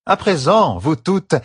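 high-pitched order to a class; commanding. Acted. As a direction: call the whole group to attention. Teacher commands the class of novices.

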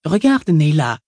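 a hint of a whiny, squeaky man. A narrator's reading. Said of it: "Il avait une petite voix couinante".

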